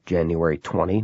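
usual speaking voice

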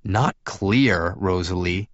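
plain reading voice

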